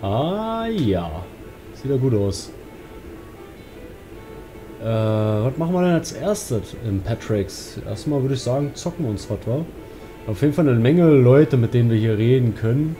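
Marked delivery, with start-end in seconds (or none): none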